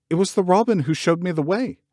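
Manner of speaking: high, stubborn insistence; girl insisting to the gardener; obstinate protest. A narrator's reading. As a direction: dig in your heels. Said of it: "she protested obstinately".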